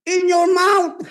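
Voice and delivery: high, loud shouted preaching; shouting at the people of God; shouting preacher. Real, not acted. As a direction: belt it out to the congregation. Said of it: "Napapasigaw na ako dito".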